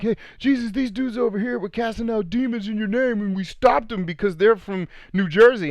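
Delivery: high, excited disciple voice, rushing up; eager disciples. Acted. Disciples running up to Jesus, high and eager. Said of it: "the disciples run up to Jesus like".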